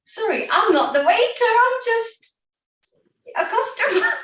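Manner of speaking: a hint of a bemused diner correcting him; stranger's mock reply. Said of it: "he says".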